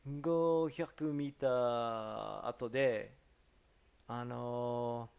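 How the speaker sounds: very slow, drawn-out imitation; mock thinking-aloud in Japanese; hesitant direction-giver. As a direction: stretch each word while working out the route. Imitating local giving rambling directions.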